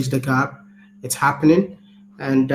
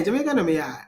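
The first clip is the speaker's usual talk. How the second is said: Jamaican accent with Ghanaian sound showing through; botched Jamaican accent; 3 semitones higher, faster than usual. Shown as a demonstration. Speaker obeys partner's request for the accent, botched Jamaican accent. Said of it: "What kind of accent is that?".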